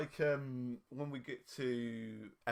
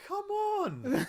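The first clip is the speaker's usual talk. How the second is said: very high, loud teasing disbelief; incredulous cry; 8 dB louder than usual. Real, not acted. Podcaster ribbing clueless co-host, incredulous cry. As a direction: cry out as if it's obvious. Teases co-host for not knowing.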